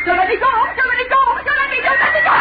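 building from loud pleading to high shrieks; panicked screaming. Acted. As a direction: plead harder each time until screaming. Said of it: "Why am I screaming?".

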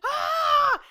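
sudden high, loud yell of pain; re-enacted yell. Client as the wax is pulled, loud acted yell. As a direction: yell out as if wax was just ripped off. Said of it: "I literally yell".

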